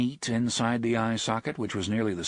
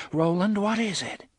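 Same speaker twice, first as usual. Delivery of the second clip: high, worried question; alarmed, pleading concern; anxious concern; 10 semitones higher, 3 dB louder than usual. A narrator's reading. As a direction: ask with rising worry. Susanna feels him trembling, asks.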